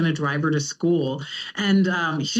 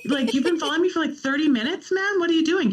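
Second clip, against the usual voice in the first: mock exasperation; 11 semitones higher, faster than usual. Continues mock-scolding son.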